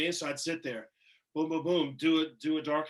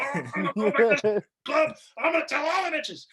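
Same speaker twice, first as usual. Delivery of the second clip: much higher and louder, gleeful; overexcited bragging to friends; giddy boast; 7 semitones higher, 8 dB louder than usual. Retold or imitated. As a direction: crow it like you've already made it. Acting his giddy boast.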